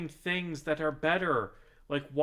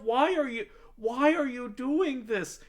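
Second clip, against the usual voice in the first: higher, pleading exasperation; high disbelieving why; exasperated rant; 10 semitones higher, 3 dB louder than usual. Real, not acted. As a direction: beg them to stop, half laughing. Ranting at football fans.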